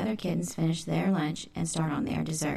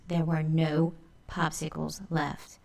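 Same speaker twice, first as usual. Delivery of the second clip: usual speaking voice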